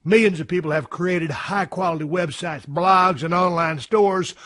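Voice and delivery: upbeat sponsor pitch; ad read. Acted. Host reading a sponsor spot to his audience, upbeat and salesy. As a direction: sell the website builder to the listeners.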